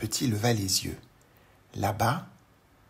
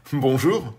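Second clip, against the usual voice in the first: louder, ordinary-pitched grown-up greeting; postman's voice; 4 semitones higher, 9 dB louder than usual. Acted. Postman answers the tiny man, louder grown-up voice.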